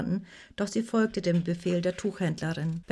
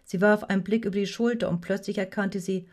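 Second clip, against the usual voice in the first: plain reading voice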